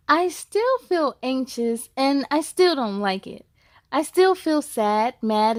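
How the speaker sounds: put-on bright, steady read-aloud for kids; story narration. Reads picture book aloud.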